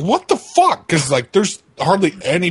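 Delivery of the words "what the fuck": high startled yelp; retold startled shout. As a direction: squeal it in shock. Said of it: "I was like".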